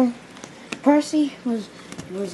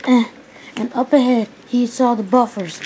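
ordinary delivery

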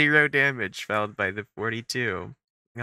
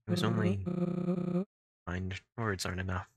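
sorrowful weary character; 6 dB quieter than usual. Acted. Reads game character dialogue.

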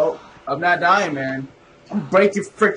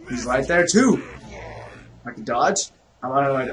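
ordinary talk

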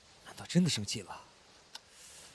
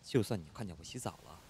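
normal talking voice

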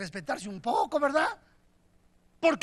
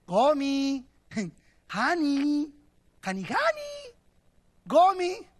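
calling out; 3 semitones higher, slower than usual. Retold or imitated. Hosea calling for his wife at home, rising call. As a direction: call her name around the house.

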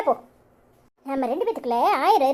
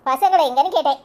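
normal talking voice

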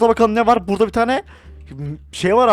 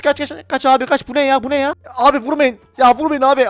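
high frantic yelling; panicked; 5 semitones higher, 5 dB louder than usual. Real, not acted. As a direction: run for your life, shouting. Fleeing zombies in game.

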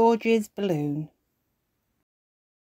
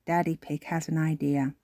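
usual speaking voice

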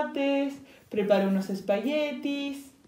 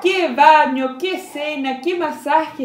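loud, high joyful exclamation; exclaiming; 6 semitones higher, 11 dB louder, slower than usual. Acted. Piglet celebrates his lucky day, loud exclaiming. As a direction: burst out in delight. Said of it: "exclamó el cerdito".

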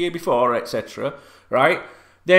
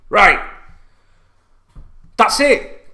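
normal talking voice

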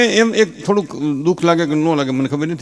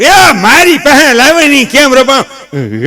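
put-on much higher, louder, slow old granny voice; grandmother; 9 semitones higher, 5 dB louder, much slower than usual. Grandmother begs for the crying baby, high and slow. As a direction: stretch out the plea like an old granny. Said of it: "grand mother".

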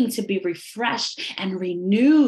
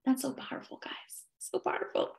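awed whisper; 14 dB quieter, faster than usual. Real, not acted. Awed aside to the congregation.

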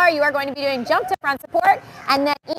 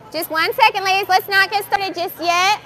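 higher, singled-out call to the group; calling to wait; 6 semitones higher than usual. Real, not acted. Coach asks the girls not to start yet, calling out higher. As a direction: hold them back with a lifted voice.

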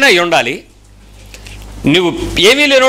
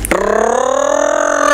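long rolled car trill with the mouth; imitated engine noise; much slower than usual. Preacher mimics a boy playing cars with his mouth, rolled engine noise. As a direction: mimic a child revving a toy car. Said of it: "అంటున్నాడు ఆ నోటితో సప్పుడు".

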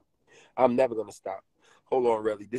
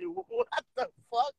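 high, mock-shocked old lady voice; hammed-up granny shock at the picture; imitating grandmother; 5 dB louder than usual. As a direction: squeal it like a startled grandma. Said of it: "she said".

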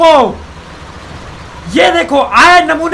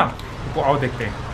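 hushed; 13 semitones lower, 12 dB quieter, slower than usual. Real, not acted. Hushed tense moment.

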